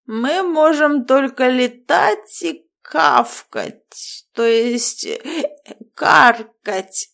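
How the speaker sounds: put-on slow, slurred, giggling drunk. A narrator's reading. Drunk raven rambling.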